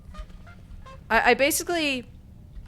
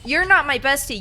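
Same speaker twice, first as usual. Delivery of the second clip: mock offended; 5 semitones higher, 9 dB louder than usual. Real, not acted. Scolding game companion.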